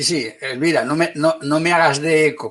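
ordinary talk

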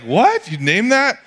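mock shock at naming it; mock incredulity. Pastor mocking someone who names his burrito, high incredulous. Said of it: "It's like".

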